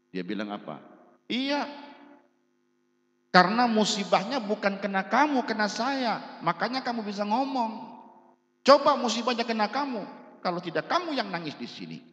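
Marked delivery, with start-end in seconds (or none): 1.3-12.0 high, loud retort of a grieving woman; loud, high-pitched indignant scolding; acted angry outburst. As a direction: act out an angry mourner lashing back. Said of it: "Dia marah".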